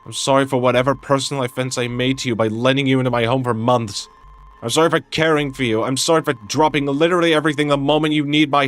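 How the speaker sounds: heated, rapid-fire mock apology; angry sarcastic apology. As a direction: throw each bitter 'sorry' at them, building in volume. Bitter sarcastic apology mid-quarrel.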